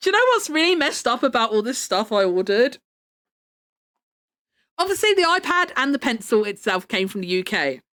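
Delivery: ordinary talk, nothing marked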